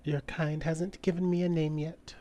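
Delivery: high and soft, an ancient being; mysterious entity. Acted. Ancient entity answers Beatrix softly in her mind. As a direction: speak softly, like something old and unseen.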